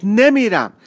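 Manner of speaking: loud, high cry of no; girl shouting her refusal at the boy; shouted refusal. Acted. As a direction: yell it with all her strength. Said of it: "دختر با همهٔ وجود داد زد".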